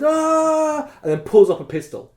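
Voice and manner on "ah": high loud fake yell of pain; hammed-up scream of a hit; mock pained yell. Wounded rabbit yelling after the axe hit, mock scream. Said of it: "and he goes".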